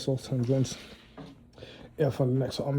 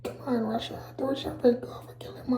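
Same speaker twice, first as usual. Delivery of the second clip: high, breathy whisper of a goading bear; high whisper urging Russia to fight; 11 semitones higher, 3 dB quieter than usual. Retold or imitated. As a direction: hiss it up high like a creature egging Russia on. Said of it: "what this bear sound like?".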